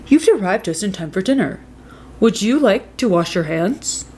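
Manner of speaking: a lightly voiced storybook dad. A narrator's reading. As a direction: read as the friendly father.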